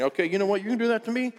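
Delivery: high-pitched, put-on indignation; mock angry. Imagined Jesus mad at Peter for the denial, mock angry. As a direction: play at being mad, voice climbing high. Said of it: "Jesus is mad and saying".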